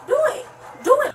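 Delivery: taunting dare. Real, not acted. Arrestee daring the cop.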